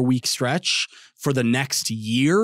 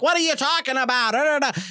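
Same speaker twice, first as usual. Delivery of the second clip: Mike Francesa impression; 11 semitones higher than usual.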